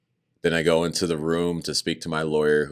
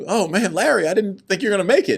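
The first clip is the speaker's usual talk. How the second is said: much higher, louder and fast with relief; relieved excitement; 7 dB louder, faster than usual. Retold or imitated. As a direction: gush with relief, pitch up high. Relieved to see lawyer.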